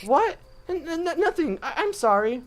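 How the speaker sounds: put-on high, stammering startled girl; flustered girl voice. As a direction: stutter it nervously. Heroine flustered.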